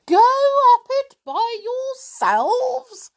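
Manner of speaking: very high, slow horrified elf voice. A narrator's reading. Elf shocked the children would climb alone, horrified. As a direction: gasp it out in disbelief. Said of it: "in horror".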